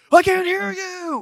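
high, slow hyped-up call to the group; rally them like a pumped instructor; hyped yell. Retold or imitated. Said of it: "what does he say every time".

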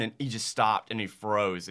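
ordinary talk

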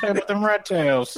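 put-on high-pitched old-time gangster voice; hammed-up nineteen-twenties wise guy; 1920s voice. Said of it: "trying to do a nineteen twenties voice".

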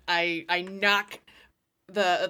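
ordinary talk, nothing marked

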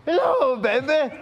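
high, loud, hammed-up Irish accent; badly faked Irish catchphrase; showy greeting in a mock Irish accent; would-be Irish accent. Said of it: "You wanna hear it in Irish?".

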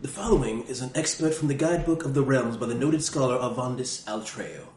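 put-on British scholar reading a guidebook; hammed-up British guidebook narration; silly British accent. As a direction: read it out in a mock-British narrator voice. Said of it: "I'm using a British accent".

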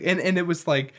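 ordinary delivery

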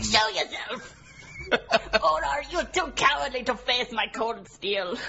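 slow, loud heroic taunt; bold young warrior. Pretend. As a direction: challenge the coward like a knight. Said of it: "I'm trying multiple accents".

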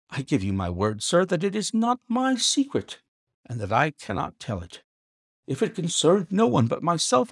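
high, desperate servant; high anguished pleading voice; pleading butler. A narrator's reading. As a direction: beg him not to ask. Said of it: "like one who was in the last extremity of doubt and misery".

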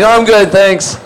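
high, over-polite mock thanks; nervous polite refusal. Recalling refusing food thrown by a grimy co-star, nervously polite. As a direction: act out an uneasy, high-pitched "no thanks".

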